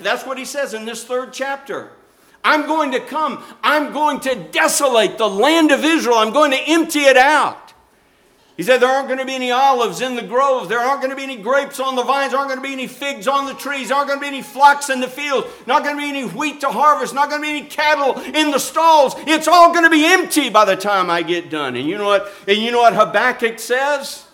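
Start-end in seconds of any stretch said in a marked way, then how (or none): 2.4-7.7 high, loud, forceful proclamation; God pronouncing judgment. Acted. God warning He will desolate Israel, wrathful proclamation. 8.8-21.8 fast, high, mounting list of doom; relentless warning. Acted. God telling the prophet all will be empty, relentless warning. God listing the coming desolation.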